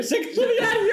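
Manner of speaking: high, laughing mock reading; laughing punchline. Real, not acted. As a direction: crack up while calling out the answer, pitch shooting up. Joking answer to the leaflet question.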